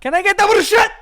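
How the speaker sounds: put-on shouted, high Italian-accented order; over-the-top Italian. Mocking someone ordering bruschetta, shouted exaggerated Italian. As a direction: yell the order in hammy Italian. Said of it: "hear fucking Giada De Laurentiis say it".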